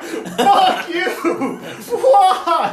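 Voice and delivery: very high, loud wail through laughter; comic retold fury, stretched out; outraged yell. Acted outburst at the heckler.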